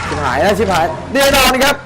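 high, loud alarmed yell; panicked shout. Real, not acted. Gamer shouting as the monster kills him, panicked yell. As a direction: shout in real panic as it goes wrong. Gamer about to die in-game.

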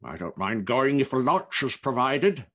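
loud, put-on old gentleman's voice; gentleman with nose growth. A narrator's reading. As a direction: play a self-important businessman. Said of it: "the gentleman with the growth on his nose".

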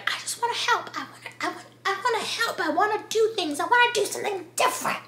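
put-on high, soft, breathy-free girlish plea; mock the wide-eyed heroine, up high; naive girly voice. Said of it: "she's like".

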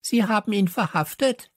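put-on high-pitched screechy girl; shrieking. A narrator's reading. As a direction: screech the news out. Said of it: "kreischte Stella".

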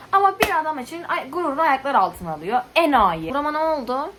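loud, high-starting indignant rant; annoyed outburst. Real, not acted. Reviewer fuming over the heroine trampling her pride, annoyed. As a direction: burst out in exasperation at her foolishness. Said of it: "ay vallahi çok sinirlendim ya".

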